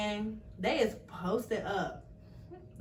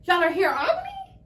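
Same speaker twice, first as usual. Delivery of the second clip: higher and louder shocked question; surprised disbelief; 7 semitones higher, 5 dB louder than usual. Retold or imitated. Daughter hearing family arrived early, surprised high question. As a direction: sound caught off guard. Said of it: "And I was like".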